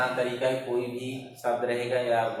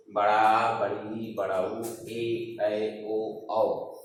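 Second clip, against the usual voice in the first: slow vowel drill; much slower than usual. Shown as a demonstration. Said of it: "दीर्घ स्वर है".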